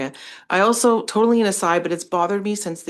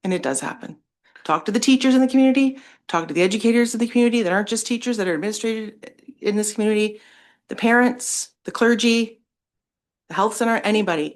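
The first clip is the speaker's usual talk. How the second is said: angry; 3 semitones higher than usual. Real, not acted. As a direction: rush it out in real anger. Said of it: "it angers me".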